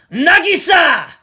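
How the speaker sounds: calls the name out at full, drawn-out volume; hoarse shout. A narrator's reading. Martin calling out to Nagisa, a loud, drawn-out yell. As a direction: yell the name across the park. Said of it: "he shouted hoarsely".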